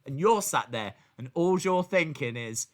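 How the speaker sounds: usual speaking voice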